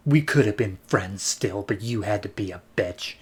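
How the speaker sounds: low, soft and cold; bitter parting shot. Retold or imitated. Rose to Caitlin as the Ravagers leave, low and bitter. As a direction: mutter a spiteful goodbye. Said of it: "Rose gives a look to Caitlin".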